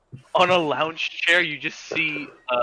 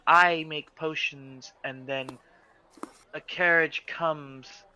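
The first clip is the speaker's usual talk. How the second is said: slow confused explanation; 3 dB quieter, slower than usual. Acted. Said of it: "she goes".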